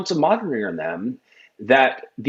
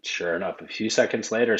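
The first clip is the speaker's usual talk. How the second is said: ordinary delivery, nothing marked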